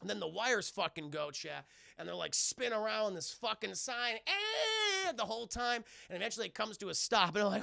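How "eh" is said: long, high "eh" wail; mock spinning scream. Heroes spinning around the sign, mock long scream. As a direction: hold a comic scream.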